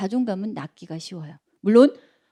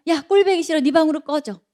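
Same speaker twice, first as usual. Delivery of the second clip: raised, louder mock scolding; angry parent; 6 semitones higher, 5 dB louder than usual. Parent scolding child for one mistake, mock angry shout. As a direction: snap at the child to get out. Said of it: "하나라도 다르면".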